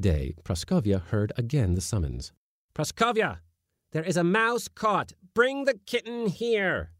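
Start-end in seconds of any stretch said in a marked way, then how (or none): 2.7-6.9 calling out. A narrator's reading. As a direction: summon her from afar. Calling housekeeper again.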